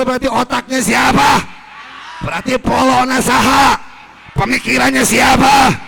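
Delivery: loud, fast, shouted sermon; fiery preaching. Acted. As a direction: whip the congregation up with every question. Preacher shouting at full force. (ceramah berapi-api dengan suara lantang)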